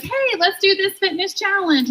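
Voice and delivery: high-pitched, chirpy suggestion; imitated upbeat encouragement; mock peppy friend. Friends cheerily propose a challenge.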